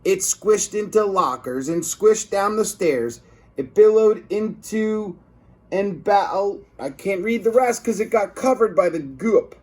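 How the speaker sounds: plain reading voice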